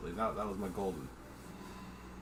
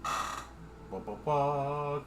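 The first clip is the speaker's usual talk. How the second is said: normal talking voice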